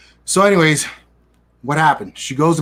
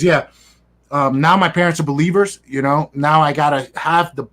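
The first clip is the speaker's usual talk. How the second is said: normal talking voice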